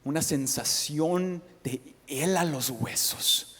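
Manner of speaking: usual speaking voice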